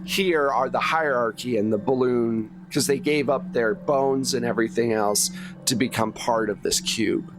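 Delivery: ordinary talk, nothing marked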